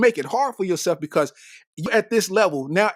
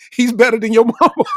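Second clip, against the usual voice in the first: normal talking voice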